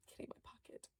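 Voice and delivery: breathless gasped whisper, fast; excited whisper. Real, not acted. To viewers, thrilled at finding the toy, a gasped whisper. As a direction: hush it out in delight.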